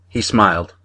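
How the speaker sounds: usual speaking voice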